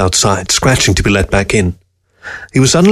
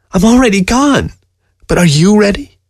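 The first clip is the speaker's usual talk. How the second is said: sad voice; 11 semitones higher than usual. A narrator's reading. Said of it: "His voice sounded sad".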